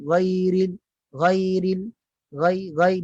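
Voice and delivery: GHAIRIL with a thin, open 'ai'; low articulation. Shown as a demonstration. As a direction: keep the tongue root down on ghai. (ghai rendah, lidah tidak dinaikkan)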